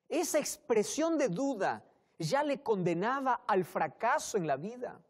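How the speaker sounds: ordinary talk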